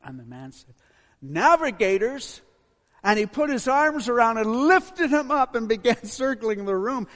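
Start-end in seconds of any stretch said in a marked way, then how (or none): 1.2-2.4 loud, high, drawn-out disbelief; astonished shout. Acted. Ugandan man hears the missionary's group named, astonished shout. 3.0-7.1 loud, high-pitched rush of joy; excited storytelling. A narrator's reading. Preacher tells of man lifting missionary up, excited shout. Excited telling of joyful embrace.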